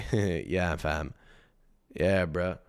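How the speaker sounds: a hint of a mumbling rapper; mock mumbling rapper. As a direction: drop low and mumble like a rapper who barely speaks. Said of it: "an unstable rapper who doesn't really speak".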